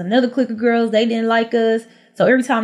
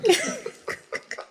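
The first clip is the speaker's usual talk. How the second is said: breathy high laugh; 11 semitones higher, 7 dB quieter than usual. Real, not acted. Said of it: "It was real dramatic".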